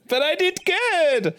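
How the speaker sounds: high, slow proud voice; high childish voice. Acted. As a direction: squeak it out proudly. Voicing a proud character.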